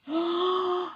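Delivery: a high theatrical achoo; hammed-up wolf sneeze; big sneeze. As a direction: let a huge sneeze burst out. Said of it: "And I sneezed once again".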